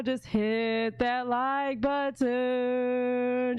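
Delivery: very slow Jamaican drawl on every word; Jamaican accent. Retold or imitated. Slow Jamaican accent telling viewers to like. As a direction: Jamaican accent stretched out long. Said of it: "She did the Jamaica version".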